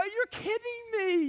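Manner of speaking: high incredulous exclaim; disbelief. Retold or imitated. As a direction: sound amazed and incredulous. Voiced disbelief.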